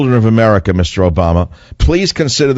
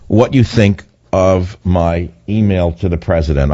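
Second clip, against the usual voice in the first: ordinary delivery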